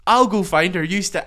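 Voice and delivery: high, loud, decisive; acted urgent orders. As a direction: imitate a friend taking charge in a flap. Friend taking charge, acted.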